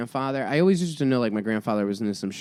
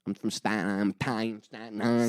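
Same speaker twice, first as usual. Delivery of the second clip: softer imitated Staten Island mother; fake Staten Island accent; 2 semitones lower, 4 dB quieter than usual. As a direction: swallow syllables, Staten Island style. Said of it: "she speaks with a Staten Island accent".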